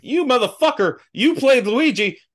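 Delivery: high, loud mock tirade; mock rant. Imagined rant at Leguizamo, high and indignant. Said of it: "And I'm like".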